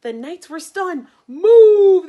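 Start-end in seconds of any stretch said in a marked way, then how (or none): 1.3-2.1 very loud, high shout. Acted. Knights shouting at Boy in the battle, loud yell. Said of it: "they shouted".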